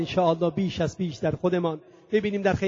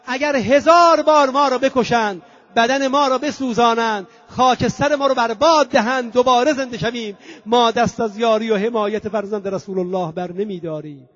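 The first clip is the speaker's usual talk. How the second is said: higher, emphatic vow easing at the end; retold impassioned cry; 3 semitones higher, 3 dB louder than usual. Second old companion vowing loyalty to death, raised impassioned cry. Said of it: "فریاد زد".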